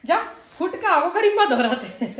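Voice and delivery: teasing go then, with laughter; half-laughing dare to the son; laughing mock scolding. Real, not acted. Mother teases her son, laughing as she scolds.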